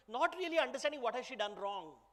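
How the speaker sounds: usual speaking voice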